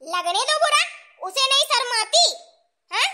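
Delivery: indignant mother-in-law outburst; defiant anger. Acted. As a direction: dismiss the plea and rant on. Mother-in-law rejects husband's plea, rants.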